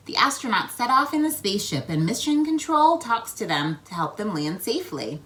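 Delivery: ordinary delivery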